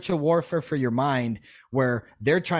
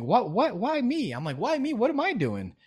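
much higher mock despair; exasperated why me; 10 semitones higher than usual. Recalling life going downhill, high mock despair. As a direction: wail it to the sky, pitched way up.

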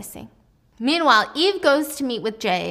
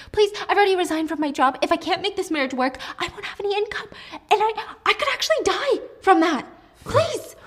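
tearful begging; 9 semitones higher, 3 dB louder than usual. Acted. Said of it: "Mina gets up crying".

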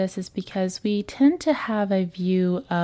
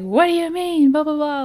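high-pitched, louder mock outburst; mock yelling; 9 semitones higher, 5 dB louder than usual. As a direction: imitate someone yelling in a huff. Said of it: "like, yelling".

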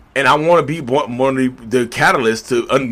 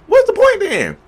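indignant high-pitched cry; incredulous high outburst; 4 dB louder, slower than usual. Real, not acted. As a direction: voice jumps way up on the question. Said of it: "rhetorical outburst".

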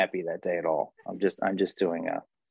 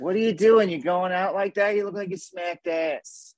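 higher, laughing scolding mom; scolding mother; 9 semitones higher than usual. Retold or imitated. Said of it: "something my mother would have said".

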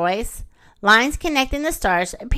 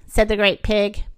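usual speaking voice

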